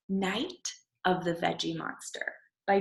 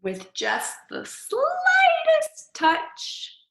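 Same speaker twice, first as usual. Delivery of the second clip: much higher, slow and drawn out; suspense; 4 dB louder, much slower than usual. Acted. Story reader building suspense as the pea touches. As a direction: stretch each word as the spell begins.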